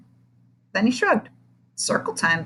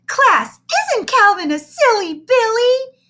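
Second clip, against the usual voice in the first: loud, high, slow gushing coo; gushing teacher; 7 dB louder than usual. Acted. Teacher coos at the big boy, loud and high. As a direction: overdo the sweetness like a doting teacher.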